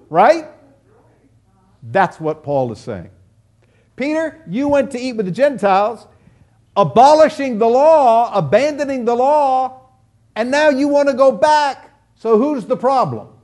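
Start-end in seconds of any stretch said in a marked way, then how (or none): 4.0-13.3 confronting Peter. Retold or imitated.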